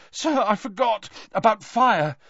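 put-on high, fast, breathless boy; panicked panting. A narrator's reading. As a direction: blurt it out, still shaken. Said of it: "panted Harry".

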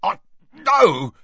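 high strangled cry; choked panic. Acted. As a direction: gasp it out while being throttled. Mundungus being choked.

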